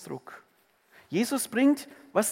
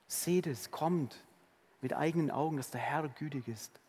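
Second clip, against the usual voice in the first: soft tender invitation; 5 semitones lower, 7 dB quieter than usual. Shown as a demonstration. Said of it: "Seht und schmeckt".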